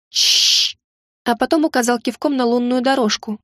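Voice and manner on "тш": резкое беззвучное шипение; цыкнуть, чтобы замолчали; шипящее «тш». Acted. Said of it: "прошипел". (беззвучное «тш» сквозь зубы)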